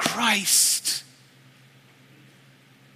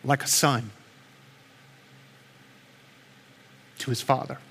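prayer; 2 semitones lower, 3 dB quieter than usual. Acted. Pastor praying over the hurting congregation, soft and earnest. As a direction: pray it quietly over the church.

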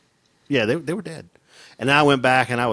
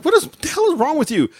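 high, sharp mock wife voice; wife asking what is wrong, high; wife alarmed. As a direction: play the startled wife. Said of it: "my wife's like".

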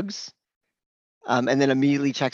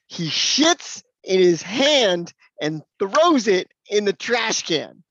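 slow, high and a bit louder blunt sister; mock sister voice; 6 semitones higher, 5 dB louder, much slower than usual. Sister telling the doctor her brother's habit, slow mock blunt voice. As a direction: spell it out slowly like a blunt sister.

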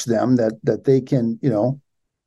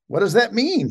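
lightly voiced bewildered questioner, higher; mock puzzled question; 7 semitones higher than usual. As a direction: imitate someone asking in puzzlement.

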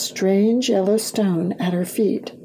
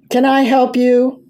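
louder, higher huffy challenge; huffed; 4 semitones higher, 6 dB louder than usual. A narrator's reading. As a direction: call it out huffily from the back. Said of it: "a voice from the back huffed".

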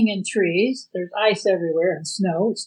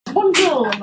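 silly high voice; 8 semitones higher than usual. Pretend. Echoing bonjour in silly voice.

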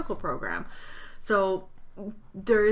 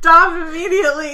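loud protest; 9 semitones higher, 12 dB louder than usual. Real, not acted. Protesting the steamy reading.

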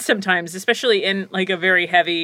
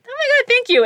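much higher gushing thanks; delighted thanks; 3 dB louder than usual. Retold or imitated. Thanking Eric's daughter for the hair compliment, gushing.

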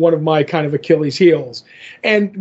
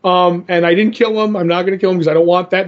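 ordinary delivery

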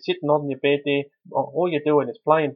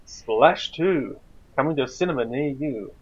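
movie trailer voice; slower than usual. Acted. Mock film trailer voice.

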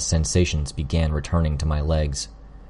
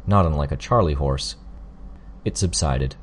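ordinary delivery, nothing marked

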